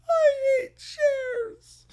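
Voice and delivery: very high, very slow hammed-up wail; mock wailing. Defeated villain wailing over the broken chair.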